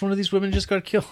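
high, fast baffled question; confused outburst. Retold or imitated. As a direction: blurt it out lost and confused. Acted confused viewer question.

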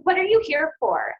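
loud, blunt nurse question. Retold or imitated. Nurse loudly asking the patient why she is here. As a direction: bark it out like the bellowing nurse.

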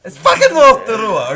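Rotorua with rolled Italian-style r's, loud; Italian-sounding Rotorua. Shown as a demonstration. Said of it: "sound like you're saying it with an Italian accent".

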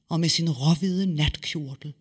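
usual speaking voice

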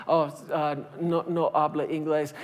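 low, soft apologetic reply; local's reply. Retold or imitated. Local telling the tourist he speaks no English. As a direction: shrug off the tourist gently.

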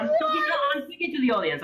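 fast, high laughing protest. Real, not acted. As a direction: insist while laughing.

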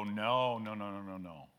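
mock stubborn "no" fading away to almost nothing; stubborn refusal. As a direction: play someone digging in their heels, mild and trailing off. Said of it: "you'll stiffen up and go".

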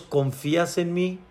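soft, quiet divine question; God's calm voice. Acted. God asks the climber for trust, softly. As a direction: ask gently and quietly.

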